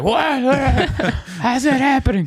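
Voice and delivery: high, squealed mock astonishment; hammed-up stunned guy, cracking up; mock disbelief. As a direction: squeal it in disbelief through laughter. Mimics a stunned beefy guy.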